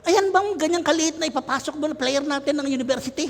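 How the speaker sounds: high-pitched disbelief; imitating a scornful coach; acted incredulous coach. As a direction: question it in high, mocking disbelief.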